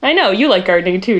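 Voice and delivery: usual speaking voice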